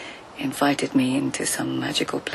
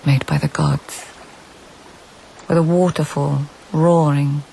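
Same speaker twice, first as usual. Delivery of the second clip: louder, measured storytelling read; story narration; 6 dB louder than usual. A narrator's reading. Narrator recounting the search through forest, storytelling voice. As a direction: paint the journey step by step.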